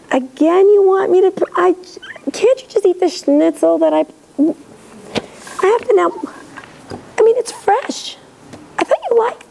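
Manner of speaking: put-on a fed-up mother scolding a kid who won't eat the schnitzel; exasperated mother. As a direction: play the put-upon mom whose dinner is refused. Annoyed parent over schnitzel.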